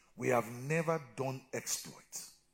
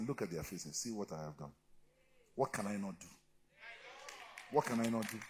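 lightly voiced God; 5 semitones lower, 6 dB quieter than usual. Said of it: "God tells me".

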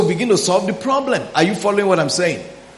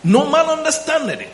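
no man understandeth it, pitched far higher; emphatic repeat; 9 semitones higher than usual. Shown as a demonstration. Preacher repeating scripture to congregation, raised high. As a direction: lift the line high to press the point home. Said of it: "No man includes your own mind".

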